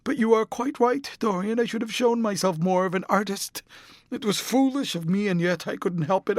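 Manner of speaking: Sybil Vane pleading. A narrator's reading. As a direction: plead desperately, voice climbing high.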